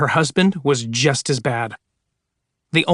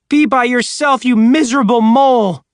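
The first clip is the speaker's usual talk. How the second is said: much higher, louder jeer; sneering shout; 9 semitones higher, 5 dB louder than usual. Acted. Woman jeers at the voice, loud sneer. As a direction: hurl the insult. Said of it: "she sneered".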